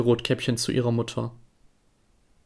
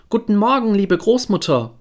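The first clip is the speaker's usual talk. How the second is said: put-on much higher, louder girlish greeting; bright girl's call; 7 semitones higher, 3 dB louder than usual. Girl enters and greets grandmother, high and louder. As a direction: call out happily like a little girl. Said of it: "und rief".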